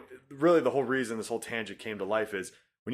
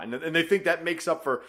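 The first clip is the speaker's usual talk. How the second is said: ordinary delivery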